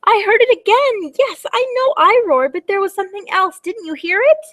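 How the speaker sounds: high, urgent storybook voice; play-acted dragon panic; alarmed little dragon. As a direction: insist in high-pitched alarm that something's there.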